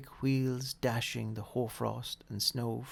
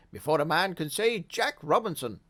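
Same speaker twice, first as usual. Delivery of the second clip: rich, fat, jolly call, louder; jovial voice; 4 semitones higher, 6 dB louder than usual. A narrator's reading. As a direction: boom it out like a merry old boss. Fezziwig clapping, calling.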